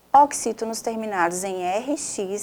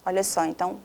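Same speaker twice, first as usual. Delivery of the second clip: ordinary talk, nothing marked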